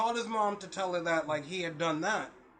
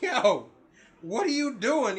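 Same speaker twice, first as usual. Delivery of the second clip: louder, higher mock scolding; retold alarmed challenge; 4 semitones higher, 5 dB louder, slower than usual. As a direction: call out in disbelief. Retold challenge to his son.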